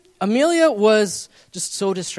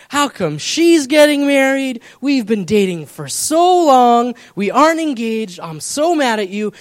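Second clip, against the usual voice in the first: higher, louder mock upset complaint; imitating her tearful outburst, half-hearted; retold angry girlfriend; 6 semitones higher, 8 dB louder than usual. Husband retelling his upset girlfriend scolding him, mock angry. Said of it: "so she's so mad. She's crying".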